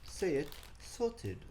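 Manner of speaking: lightly voiced station announcer; soft high British announcer; mock British announcement. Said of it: "They say".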